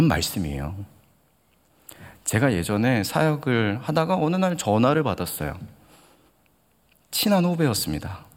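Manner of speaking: ordinary delivery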